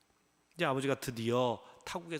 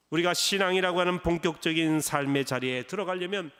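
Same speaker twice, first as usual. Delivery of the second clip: louder, rising preacher's voice; fervent preaching; 4 dB louder than usual. Acted. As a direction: proclaim it from the pulpit. Preaches at full force. (힘차게 외치는 설교)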